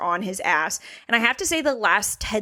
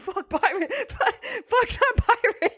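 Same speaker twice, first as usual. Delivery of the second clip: laughing; 11 semitones higher, slower than usual. Retold or imitated. Laughing mock rant.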